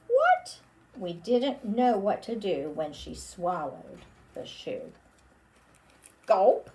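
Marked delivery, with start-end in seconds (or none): 0.1-0.6 very high, loud surprised "What?"; high comic gasp of disbelief; surprised squeak. Acted. Surprised reaction to swallowing. 6.2-6.7 loud comic gulping sound. Acted. Puppet swallowing.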